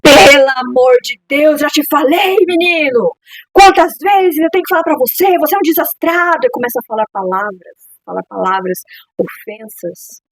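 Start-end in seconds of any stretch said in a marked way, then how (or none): 0.0-6.4 loud, high exasperated telling-off; angry parent scolding. Acted. Parent scolding own child for knocking things over, furious. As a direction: let rip at a clumsy child, forcefully. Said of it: "você fala com força".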